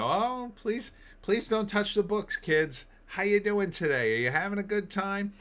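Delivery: high, sugary put-on sales voice; forced cheerful smile. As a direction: smile through it, sweet and bright. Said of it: "You just gotta smile and go".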